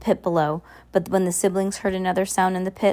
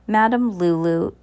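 mildly booming, grand proclamation; booming announcement; 3 semitones higher, 3 dB louder than usual. A narrator's reading. Madame Lulu announcing to the carnival crowd, mildly booming. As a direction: announce it boomingly, easing off at the end. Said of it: "announced in a booming, in a booming voice".

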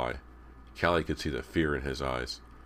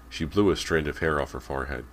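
ordinary delivery

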